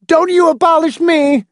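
put-on very high, loud boyish yell; belligerent roar. A narrator's reading. Boy refusing to be abolished, belligerent high roar. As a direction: yell back like a defiant boy. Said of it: "David roared belligerently".

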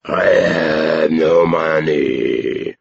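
slow, long-drawn dog howl; talking dog. A narrator's reading. Dog explains why it can't bet, slow howling dog voice. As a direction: stretch every word into a doggy howl. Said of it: "he added".

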